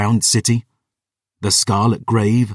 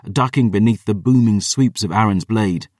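ordinary delivery

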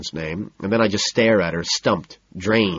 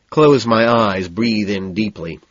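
ordinary delivery, nothing marked

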